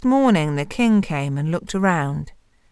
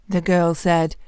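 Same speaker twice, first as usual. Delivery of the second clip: ordinary delivery, nothing marked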